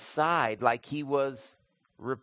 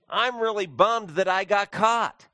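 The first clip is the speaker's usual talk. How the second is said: higher, louder whiny mock regret; mock sulky regret; 8 semitones higher, 7 dB louder than usual. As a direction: ham up a sulky kid caught out.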